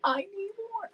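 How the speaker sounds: lightly acted film heroine line; imitated movie heroine. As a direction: quote the heroine lightly, pitched well up. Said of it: "and she's like".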